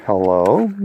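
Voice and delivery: plain puzzled hello back to the caller. Retold or imitated. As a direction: ask who it is. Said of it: "I'm like".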